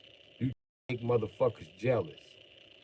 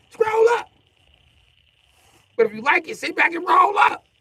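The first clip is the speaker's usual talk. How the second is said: high, loud shouted rhyme; 10 dB louder than usual. Real, not acted. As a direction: belt it out at the viewers. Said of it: "So I get loud when I wanna get loud".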